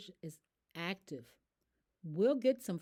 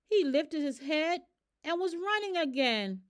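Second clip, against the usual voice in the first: excited; 8 semitones higher, 5 dB louder than usual. A narrator's reading. Sudden excitement.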